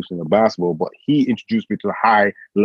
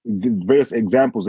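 ordinary talk

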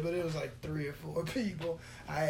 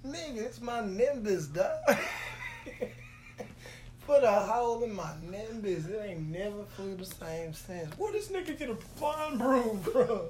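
higher-pitched playful complaint; comic aggrieved outburst; mock indignation; 5 semitones higher than usual. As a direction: gripe like a wronged broom owner, hammed up. Acts out indignant broom owner.

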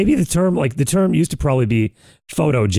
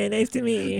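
high, soft put-on greeting voice; a prissy fake-polite hello, pitched up; mock greeting; 8 semitones higher, 7 dB quieter than usual. Mock Kamala Harris greeting.

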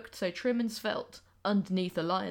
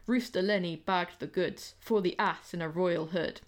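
usual speaking voice

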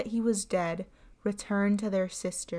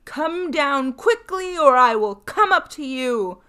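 loud bawl; 5 semitones higher, 10 dB louder than usual. A narrator's reading. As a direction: roar it up the stairs as loud as he can. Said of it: "he shouted again, and as loudly as he could bawl".

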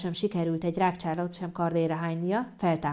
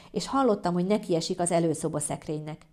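usual speaking voice

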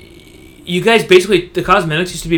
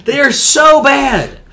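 loud, slow, high-pitched insistence; exasperated complaint; 8 semitones higher, 5 dB louder, slower than usual. Real, not acted. Gamer insisting to friend the skins are awful, exasperated. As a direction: hammer it slowly, loud and high. Ranting about terrible skins.

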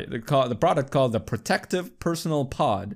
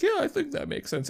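much higher imitated onlooker voice; high little voice of polite agreement; mock agreeing listeners; 4 dB quieter than usual. Voicing people around him agreeing.

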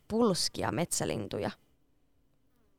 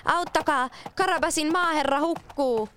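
cat shouting for help; 10 semitones higher than usual. A narrator's reading. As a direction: yell as if someone is drowning. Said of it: "alkoi huutaa kovella äänellä".

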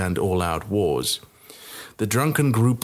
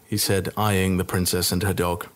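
ordinary delivery

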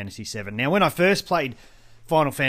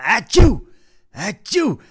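high, loud imitated double sneeze; loud mock sneeze; 8 semitones higher, 10 dB louder, much slower than usual. Retelling hay fever sneezes at the register, loud mock sneezes. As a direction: act out two huge sneezes. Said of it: "let out two of these huge".

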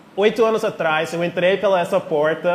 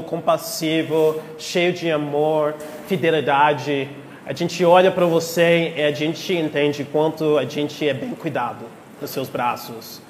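prayer; 4 semitones lower than usual. Acted. Leads a prayer.

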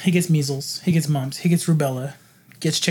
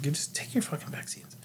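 whispered; 3 semitones lower, 13 dB quieter than usual. Real, not acted. Muttered punchline.

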